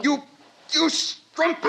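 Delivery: high, spat-out rage; furious insult. Acted. John denouncing Lenina as she pursues him. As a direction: lash out in disgust.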